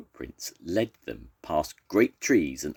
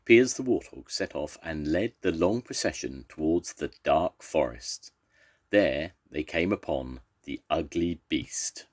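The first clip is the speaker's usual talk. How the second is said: usual speaking voice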